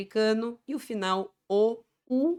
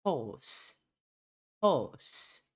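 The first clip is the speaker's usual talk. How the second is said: British "Horse" with silent r; non-rhotic British Horse; British pronunciation; 2 semitones lower than usual. Shown as a demonstration. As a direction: say Horse as "hawss". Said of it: "o R tem uma pronúncia mais forte".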